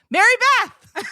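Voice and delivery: high, loud shout across traffic; calling out. Retold or imitated. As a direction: yell the name from a passing car. Guys in a Jeep calling out.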